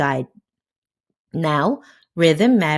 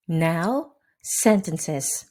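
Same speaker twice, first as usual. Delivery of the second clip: ordinary talk, nothing marked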